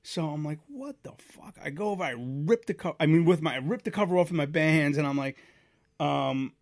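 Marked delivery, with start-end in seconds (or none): 0.7-1.6 high, hushed mock disbelief; stunned disbelief. Man finding his radiator spraying water, hushed high disbelief. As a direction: gasp it out softly, acting stunned.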